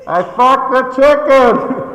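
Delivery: slow, high, loud yell; yelled confession. Retold or imitated. Guy yelling back to his sponsor from the door, slow yell. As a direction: shout it back across the room. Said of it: "he yells back".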